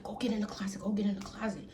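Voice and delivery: lightly imitated hushed urgency; half-whispered mock order to hide; urgent hushed retelling. Panicked boyfriend hides her in closet.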